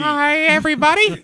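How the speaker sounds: mock cartoon squeak greeting; squeaky high voice. Answering the mockery in a squeaky cartoon voice. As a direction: pitch way up like a cartoon mouse. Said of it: "I don't sound like Mickey Mouse".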